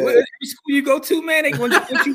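mock disbelief. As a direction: playfully act shocked at the guest's luck. Teasing mock disbelief at guest.

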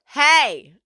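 high yelled "Hey!"; mock yell. Patton calling after Michelle leaving the bar, a yell. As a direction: call out after her across the bar. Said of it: "and he yells".

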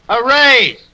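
second, bigger a raise?; incredulous repeat. Acted. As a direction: throw the word back in shock. Louder repeated disbelief.

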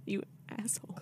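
whispered playful insult. Real, not acted. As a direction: hiss it under breath. Playful insult after teasing.

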